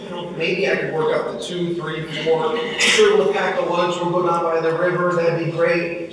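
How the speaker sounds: a self-mocking burst of naive enthusiasm; mock eager optimism. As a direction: talk himself into a picnic ride, all hopeful. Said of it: "and I think".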